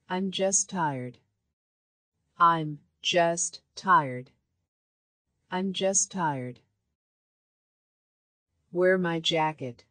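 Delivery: usual speaking voice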